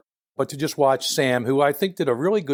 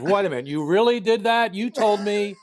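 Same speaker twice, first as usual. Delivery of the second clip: mock surprise; 8 semitones higher than usual. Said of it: "A couple of times".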